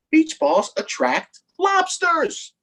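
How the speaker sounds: high, slow worried reveal; mock-ominous reveal; slow dramatic punchline. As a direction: draw out the silly fear.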